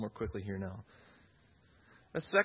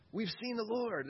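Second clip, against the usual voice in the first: high, bright cry of news; excited exclamation; 9 semitones higher than usual. Retold or imitated. Apostles telling Thomas, excited exclamation. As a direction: burst out with joy at seeing him. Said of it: "There's this exclamation with it".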